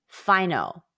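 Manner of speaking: ordinary talk, nothing marked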